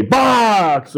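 high, held-out team cheer; mock cheer. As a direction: imitate a unit's triumphant call. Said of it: "would give a big cheer".

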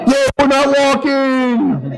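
very high, slow drawn-out cheer; mock yell. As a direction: whoop it like a relieved crowd. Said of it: "what did they yell?".

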